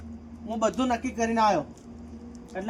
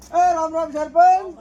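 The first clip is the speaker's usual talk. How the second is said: calling out greeting; 7 semitones higher, 9 dB louder, slower than usual. Acted. Loud greeting to the sarpanch.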